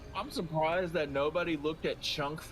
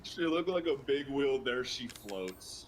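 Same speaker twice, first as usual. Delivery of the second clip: slow drawn-out parody; 5 semitones lower than usual. Acted. Copies the whale rhyme.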